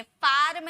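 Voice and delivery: फार्म drawn out with a clear R; wrong full R. Shown as a demonstration. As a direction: sound every bit of the R in फार्म. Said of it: "फार्म नहीं". (फार्म को पूरे र के साथ, खींचकर)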